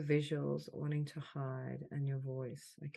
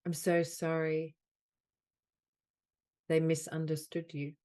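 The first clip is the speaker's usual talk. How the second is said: deliberate phrases, louder than the chat, long silences between; measured delivery, leaning on "so sorry"; guided healing affirmation; 7 dB louder, slower than usual. Acted. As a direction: offer each apology as a healing phrase. Leads healing phrases with long pauses.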